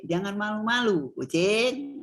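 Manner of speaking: "Jangan malu-malu" falling, then "kucing" set apart and drawn out; intonasi kalimat. Shown as a demonstration. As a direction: address "kucing" after the command "Jangan malu-malu". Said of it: "kalau kita mau jadikan kalimat juga bisa". ("Jangan malu-malu", jeda, "kucing" dipanjangkan sebagai sapaan)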